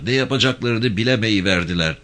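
ordinary delivery

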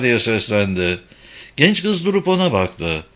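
ordinary delivery

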